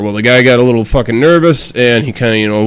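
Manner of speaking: usual speaking voice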